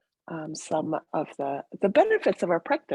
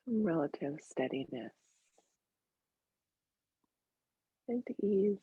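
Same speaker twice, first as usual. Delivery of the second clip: very soft, sparse meditation voice; hushed guidance, each phrase left to settle in silence; guided meditation; 12 dB quieter than usual. Real, not acted.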